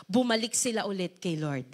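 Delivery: usual speaking voice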